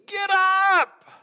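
high, full-volume yell; urgent, high-pitched scream; panicked shout. Acted. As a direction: yell to rouse someone asleep in a storm. Disciples rousing sleeping Jesus.

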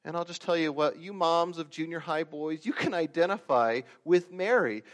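usual speaking voice

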